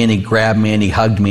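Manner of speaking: ordinary talk, nothing marked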